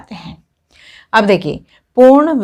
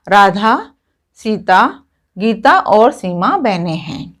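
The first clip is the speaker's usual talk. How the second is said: measured list, a small break after each of Radha and Sita; short comma pauses; slower than usual. Shown as a demonstration. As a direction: pause briefly after Radha and after Sita, then run on Gita और सीमा. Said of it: "Radha बोल करके हम थोड़ी सी देर रुके".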